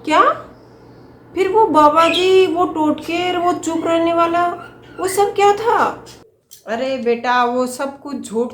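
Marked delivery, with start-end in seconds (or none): 0.0-6.0 high, loud questioning; shocked. Acted. Daughter learns the ritual was fake, shocked and loud. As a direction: demand answers in disbelief.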